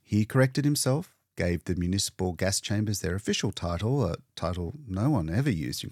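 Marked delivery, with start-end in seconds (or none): none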